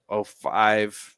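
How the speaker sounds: ordinary talk, nothing marked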